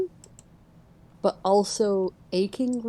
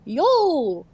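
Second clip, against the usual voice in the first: bright casual call, a bit higher and louder; cheery greeting; 6 semitones higher, 4 dB louder than usual. Acted. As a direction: toss out a friendly yo. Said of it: "raises the hand and goes".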